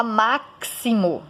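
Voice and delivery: "máximo" with X as a ks sound, MAK-si-mo; wrong pronunciation. Shown as a demonstration. Teacher shows another wrong reading, "máximo" mispronounced with ks. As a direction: say "máximo" in separate syllables, sounding the X as "ks". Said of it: "você não fala máximo".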